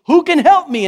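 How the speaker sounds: loud, high, anguished plea; desperate cry. Retold or imitated. Preacher voicing Paul's desperate cry, loud and high. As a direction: cry out for rescue with nothing left. Paul crying out in despair.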